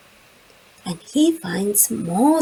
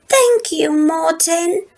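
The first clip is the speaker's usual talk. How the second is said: high, delighted thanks; grateful Lola; 7 semitones higher than usual. A narrator's reading. Lola thanking Morten for the recycling, delighted. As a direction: thank him with real joy. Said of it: "says Lola".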